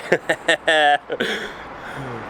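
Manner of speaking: high laugh at own blunder; embarrassed laugh. Real, not acted. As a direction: crack up over a botched take. Laughs at own botched take.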